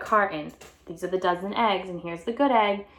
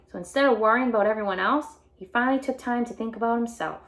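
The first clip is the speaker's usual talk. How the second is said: usual speaking voice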